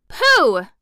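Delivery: high, loud scoff; dismissive cry. A narrator's reading. Hen dismissing the threat of the gnomes, scoffing cry. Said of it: "cried the Yellow Hen".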